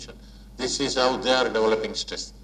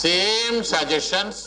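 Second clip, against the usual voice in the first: same drawn out slowly and rising; same suggestions, slowed for emphasis; stress on same; 6 semitones higher, much slower than usual. Shown as a demonstration. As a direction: lean hard on same. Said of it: "stressing identical suggestions".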